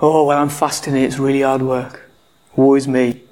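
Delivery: lightly put-on woe, pitched low; mock downcast. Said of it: "ask them why they're down".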